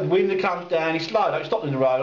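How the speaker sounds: ordinary talk, nothing marked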